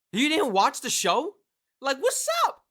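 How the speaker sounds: loud exasperated squeal of a question; incredulous. Real, not acted. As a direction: challenge them, voice shooting way up. Mock outrage at viewers' ignorance.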